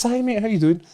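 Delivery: high, sing-song friendly hello; brightly fake-friendly; a touch of forced politeness; mock polite greeting. His own polite reply, retold.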